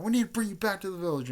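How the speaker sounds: high, rushed pleading; acted urgent plea. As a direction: hurry the plea out before the friend leaves. Retold plea to departing friend.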